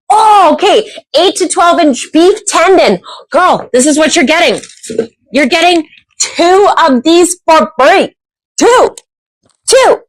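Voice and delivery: high, thrilled prize announcement; excited reveal. Real, not acted. Host announcing the beef tendon prize, high and excited.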